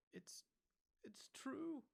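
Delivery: high voice catching and faltering; halting, barely able to say it. A narrator's reading. McGonagall admits the news to Dumbledore, faltering. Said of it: "faltered Professor McGonagall".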